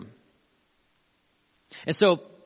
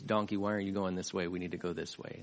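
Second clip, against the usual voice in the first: low, soft aside to a donkey; understated, low-pitched retold complaint; quiet complaint; 9 semitones lower, 10 dB quieter, faster than usual. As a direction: grumble it quietly, dropped well down. Voices rider talking to his donkey.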